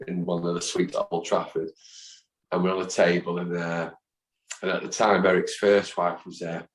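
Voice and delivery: ordinary talk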